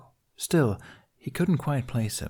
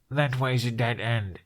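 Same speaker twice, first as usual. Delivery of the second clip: nasal after bump; 2 semitones higher than usual. Acted. Hiccup after the boy's head hit his nose, deadpan and nasal. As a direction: voice stuffed after a blow to the nose. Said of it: "how nasally his voice sounded".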